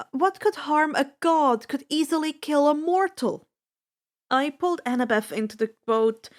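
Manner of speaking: ordinary delivery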